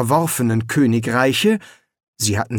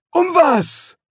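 put-on much higher, old man's voice; a fussy old explorer; old Quirm; 3 dB louder than usual. A narrator's reading. Quirm asks. (viel höhere Greisenstimme)

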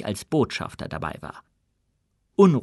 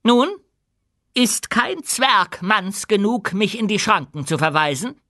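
high, deliberate open challenge; defiant queen; 9 semitones higher, 4 dB louder than usual. A narrator's reading. Queen dares any dwarf to oppose her, defiant. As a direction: dare the whole hall to answer.

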